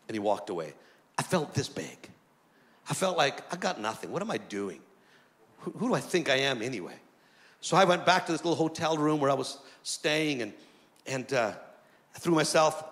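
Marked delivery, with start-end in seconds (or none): 3.5-6.9 low, quiet, deflated self-questioning; dejected self-doubt. Retold or imitated. Young preacher doubting himself after rejection, low and dejected. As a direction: sink into discouraged musing to yourself. Recalls feeling small and worthless.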